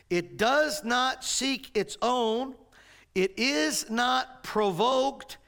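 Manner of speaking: slow, measured reading of the verse; scripture reading. Shown as a demonstration. Preacher reading Corinthians to the congregation, slow and solemn. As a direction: read each clause slowly with weight. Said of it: "we read".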